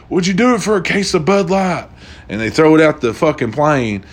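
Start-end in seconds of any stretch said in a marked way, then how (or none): 0.1-1.9 high, teasing bargaining voice; a pilot tempting the passenger with a bribe; coaxing offer. Retold or imitated. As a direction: dangle the bribe.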